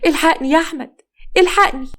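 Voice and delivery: high, frantic call to her husband; panicked cry for help. Acted. Mother calling her husband in panic, frantic high cry.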